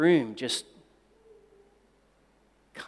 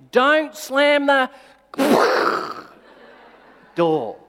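slow mock warning; 8 semitones higher, 6 dB louder, slower than usual. Calling after daughter leaving room.

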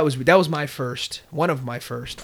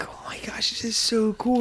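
hushed, breathy whisper of amazement; re-enacted excited whisper; awed whisper; 6 semitones higher, 5 dB quieter than usual. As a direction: whisper it like a kid in awe at the movies. Awed child in dark theater.

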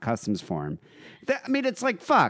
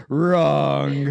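loud, drawn-out interjection; Donald Trump; 4 dB louder than usual. Retold or imitated. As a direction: cut in with a blaring mock-politician "wrong". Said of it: "When he was debating Hillary".

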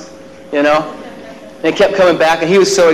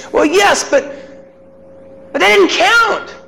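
put-on child's retort. Son answers dad, high insistent child voice. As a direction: play the boy insisting.

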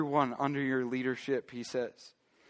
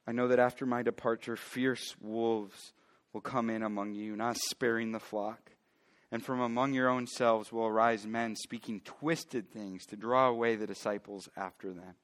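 low, quieter bible reading voice; scripture reading; 4 semitones lower, 5 dB quieter than usual. A narrator's reading. Pastor reading Acts to his church, hushed and steady. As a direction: reads the verse to the congregation, soft and measured.

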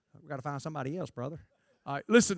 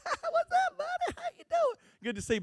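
much higher, laughing fake-cheerful hello; fake friendly greeting; 3 dB louder than usual. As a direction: gush over a rival you secretly resent. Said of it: "to their face, I'll be like".

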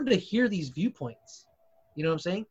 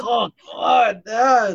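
real, loud cry of disgust; loud disgusted groan; 4 semitones higher, 9 dB louder, much slower than usual. Real, not acted. Reacting to a hideous running style, loudly disgusted.

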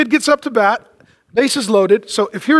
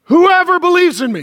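raised, higher-pitched proclamation; impassioned cry; 6 semitones higher than usual. Retold or imitated. Jesus crying out to the crowd, impassioned. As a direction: cry it out with passion, imitating. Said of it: "Jesus cried out. He's emotional about this".

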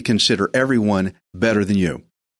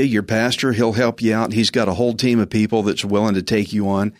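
ordinary delivery

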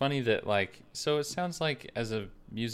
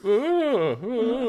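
shocked society lady; 11 semitones higher, 6 dB louder than usual. Pretend. Mock 1920s concert audience.

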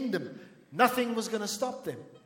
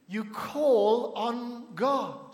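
very slow, each word drawn out; slow emphatic answer; 3 semitones higher, much slower than usual. Real, not acted. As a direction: stretch CALL, ON and GOD apart. Preacher answers own question slowly.

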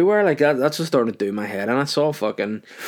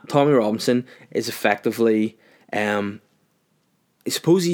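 usual speaking voice

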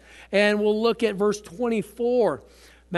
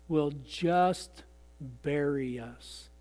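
1 low, hushed emphasis; 6 semitones lower, 9 dB quieter, much slower than usual. Real, not acted. Preacher drops to low emphasis.

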